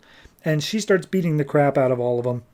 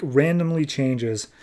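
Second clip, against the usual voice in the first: usual speaking voice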